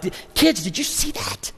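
high-pitched, giddy retold excitement; charged-up dad, acting it out; fired up. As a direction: burst to tell the kids about a stunt. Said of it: "I'm charged up".

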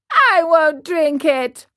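put-on high, loud childish wailing; tearful puppet boy digging in; crying tantrum. A narrator's reading. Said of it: "bursting out crying".

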